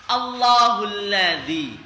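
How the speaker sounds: Allahul ladzi read slowly with a heavy L; slow heavy recitation. Shown as a demonstration. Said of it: "Contoh di awal kalimat".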